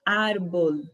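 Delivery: "Árbol" with an open a; Spanish árbol. Shown as a demonstration. Teacher leading students in árbol. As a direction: repeat "Árbol" for the class to copy. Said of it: "Pronunciem comigo".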